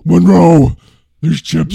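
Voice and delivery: loud pompous mock voice; blustering wife. Wife bellowing at husband, blustering. Continuing the Ted Knight wife.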